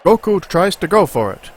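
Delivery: loud, high cartoon announcer. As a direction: hype it like a TV trailer. Mock TV show trailer.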